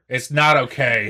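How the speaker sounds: deadpan refusal. Pretend. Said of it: "Great would be just like".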